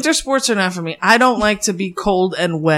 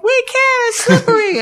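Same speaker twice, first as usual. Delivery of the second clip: high mock voice. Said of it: "and they're like".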